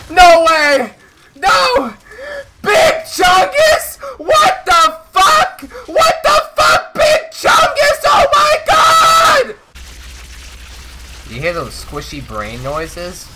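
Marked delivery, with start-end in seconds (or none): 0.1-9.6 high, wailing mock anguish; crying shout. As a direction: howl it out in fake despair. Boss turns into Big Chungus.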